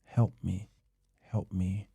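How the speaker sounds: soft, subdued retold pleading; slow, hushed imitation of begging. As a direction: retell a tearful plea quietly, without crying. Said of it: "begging her".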